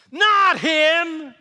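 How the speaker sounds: high, very loud refusal; mob scream. Acted. Crowd rejecting the governor's offer, screaming. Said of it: "they screamed".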